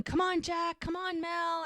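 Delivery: high-pitched retold cheer; cheering crowd. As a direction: imitate spectators egging them on. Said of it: "people are yelling".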